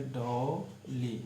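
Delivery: डॉली stretched very slowly, falling at the end; teacher stretching डॉली very slowly; drawn-out word. Shown as a demonstration. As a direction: draw out डॉली slowly for students. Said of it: "लिखेंगे कैसे".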